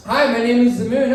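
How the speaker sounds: high, loud, quick greeting; high-pitched, hurried Moon voice; the Moon introducing itself. Acted.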